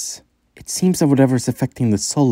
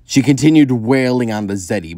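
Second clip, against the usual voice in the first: ordinary delivery, nothing marked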